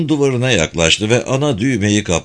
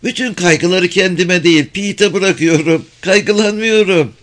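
high breezy woman's voice; giggly, carefree, pitched high; Mrs. Phelps; 8 semitones higher than usual. A narrator's reading.

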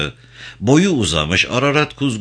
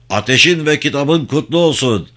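raised, high parade-ground call; military salute; 6 semitones higher, 4 dB louder than usual. A narrator's reading. Commander saluting his assembled guerrillas, calling out. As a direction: call it out to the ranks.

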